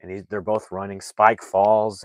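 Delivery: normal talking voice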